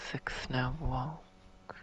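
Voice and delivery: slow, hushed, unvoiced murmur; whispered musing. Shown as a demonstration. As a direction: whisper it to yourself, puzzled. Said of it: "Not entirely sure what that means".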